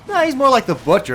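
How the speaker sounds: high, loud retold protest; mock objection. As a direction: cut in with a squealed counterclaim. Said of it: "And I'm just like".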